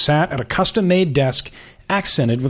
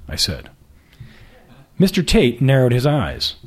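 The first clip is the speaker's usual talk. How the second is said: usual speaking voice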